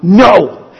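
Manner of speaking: one forceful, standalone NO; emphatic no. Shown as a demonstration. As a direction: drive the word NO home. Demonstrates emphatic form of no.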